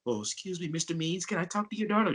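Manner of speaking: high, soft, timid caller voice; imitated polite caller. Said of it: "they pick up the phone and they go".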